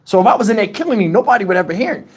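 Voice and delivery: ordinary talk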